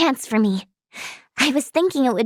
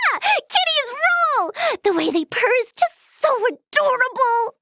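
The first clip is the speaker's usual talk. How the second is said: high squeal of delight; gleeful; 6 semitones higher than usual. Acted. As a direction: cheer and gush like a kitten fan. Cheering for kittens as pets.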